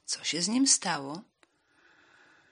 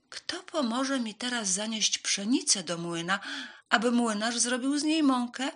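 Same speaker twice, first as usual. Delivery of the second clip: higher storybook hen; raised, questioning hen voice; hen asking for help; 6 semitones higher, 5 dB louder than usual. A narrator's reading.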